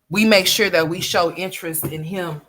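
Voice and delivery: ordinary talk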